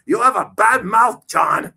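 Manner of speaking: high, loud mock scold; scolding older aunt. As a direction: tut him like a prim aunt. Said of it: "she goes".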